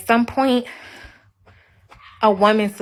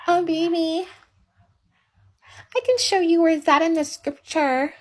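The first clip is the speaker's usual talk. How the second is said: higher-pitched mock sweet voice; meek, gentle wife; 5 semitones higher than usual. Wife coaxing her husband with scripture, mock meek and gentle. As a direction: put on a meek, obliging little voice. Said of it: "Now let me use the voice".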